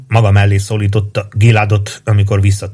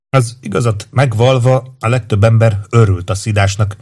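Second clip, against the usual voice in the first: ordinary delivery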